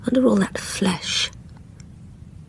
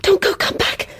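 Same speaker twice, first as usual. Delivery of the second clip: desperate pleading; 13 semitones higher than usual. Acted. Pleading with a vanishing ghost.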